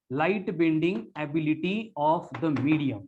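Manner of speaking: "Light bending ability of the medium" said as one slow, unbroken phrase; dictated in one phrase. Shown as a demonstration. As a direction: read the whole definition back slowly without stopping. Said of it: "In simple words". (पूरी परिभाषा एक साथ धीमे-धीमे दोहराना)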